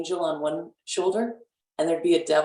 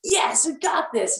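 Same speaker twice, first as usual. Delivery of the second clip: high peppy cheer, drawn out a little; root for yourself like a cheerleader; mock cheerleader rah; 11 semitones higher than usual. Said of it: "a cheerleader voice going".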